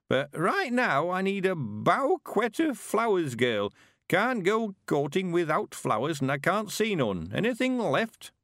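put-on high, slow creaky old man voice; an eager old countryman, chuffed; old Mister Weevil.

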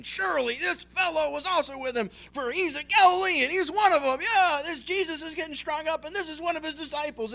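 high, loud, sure accusing voice; a confident bystander loudly marking Peter as a Galilean; confident accusation. Acted. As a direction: nail him, you're certain.

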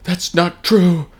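high, slow, whiny Luke denial; hammed-up Luke disbelief; Luke impression. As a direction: stretch it out like a heartbroken farm boy. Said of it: "and it's just like".

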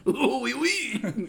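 very slow, high mock French accent, laughing; drawn-out cartoon French oui oui; laughing fake French accent; bad French accent. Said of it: "we have the worst French accents".

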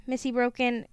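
usual speaking voice

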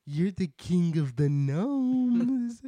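high, slow, grand announcement; hyping up. Acted. Ozma hyping up the Gnome King, high and slow. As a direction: build him up, slow and proud. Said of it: "Ozma's like".

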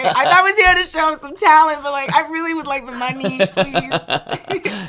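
high, loud laughing exasperated queen; frazzled drag queen, laughing through it; Jinx impression. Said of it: "your Jinx is really good".

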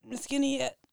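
talking while holding breath; strained, held-breath voice. Real, not acted. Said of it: "I'm holding my breath".

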